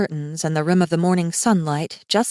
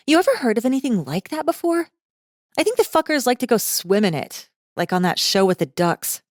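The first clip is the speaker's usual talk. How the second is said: put-on higher, breezy, careless girl; a flippant rich girl, pitched up; young woman's voice; 6 semitones higher than usual. The girl brags about her parents' cash, breezy and high.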